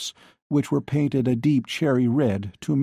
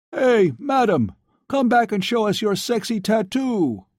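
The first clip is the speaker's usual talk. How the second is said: put-on drunken shouting; 10 semitones higher than usual. A narrator's reading. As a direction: yell it like a rowdy drunk. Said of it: "a drunken male voice shouting".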